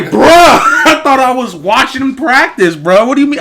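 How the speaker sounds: loud, high, comic outrage; retold indignant outburst. Kid home from surprise practice, pissed. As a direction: protest in exaggerated disbelief. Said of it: "I'm pissed. I'm like".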